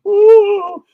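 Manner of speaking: high, loud mock wail; imitated crying. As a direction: imitate a high-pitched sob.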